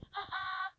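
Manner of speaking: high short burst of laughter; uncontrollable laugh. Acted. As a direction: crack up helplessly.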